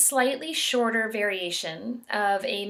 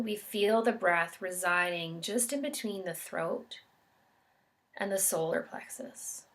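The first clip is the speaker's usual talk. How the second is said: calm, measured meditation guide with long pauses; guided meditation. Real, not acted. Yoga instructor guiding listeners into an expansiveness meditation, calm and unhurried. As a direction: guide them gently through each breath, leaving space between phrases.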